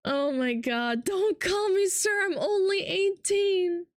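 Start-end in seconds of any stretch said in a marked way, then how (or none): 1.0-3.9 high mock-pleading voice, slowing at the end; hammed-up high protest; imitating young viewer. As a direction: play the bashful teenager, pitched up.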